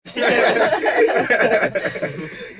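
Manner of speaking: big, loud burst of laughter. Real, not acted. Laughs at "insert here" joke.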